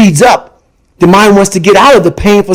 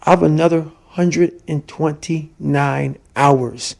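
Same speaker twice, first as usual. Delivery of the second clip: much softer, slow, word by word; dawning inner thought; 3 semitones lower, 10 dB quieter, slower than usual. Acted. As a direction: think it to yourself, hushed and slow. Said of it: "starts to think".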